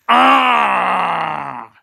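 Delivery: loud, high cartoon scream; [name] screaming. [name] showing fans his loud high scream. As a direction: let out one big high scream. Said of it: "We haven't heard [name] scream very loudly".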